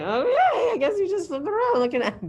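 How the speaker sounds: high, laughing mock-agreement; imitating her confused self. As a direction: play along, baffled and amused. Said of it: "I was like".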